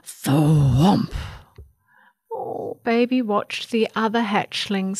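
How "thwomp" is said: low, loud thud noise; crash-landing thwomp. A narrator's reading. Baby Bird crashes while trying to fly, low loud thwomp. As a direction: drop the voice into a heavy thwomp.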